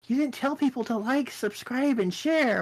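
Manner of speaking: high-pitched mimicked complaint; mock scolding critic. Imitating people scolding streamers about subscribing, high mock reproach. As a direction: whine it up high as someone else's reproach.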